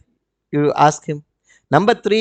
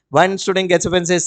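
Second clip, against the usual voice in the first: ordinary talk, nothing marked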